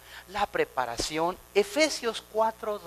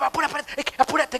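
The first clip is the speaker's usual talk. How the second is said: impatient rush; 7 semitones higher, 3 dB louder, much faster than usual. Retold or imitated. Mimicking impatient people.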